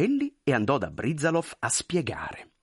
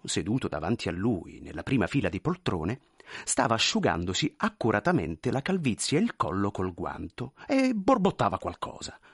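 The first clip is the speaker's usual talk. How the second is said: ordinary delivery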